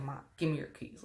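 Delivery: hushed aside to mom, not obvious; discreet hushed ask. Retold or imitated. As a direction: slip it in quietly so the robber won't notice. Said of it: "I didn't make it that obvious".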